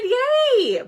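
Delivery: high, loud cheer of delight; excited yay. Real, not acted.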